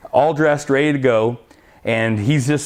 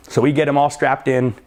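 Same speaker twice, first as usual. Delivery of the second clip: plain reading voice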